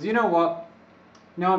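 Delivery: ordinary talk, nothing marked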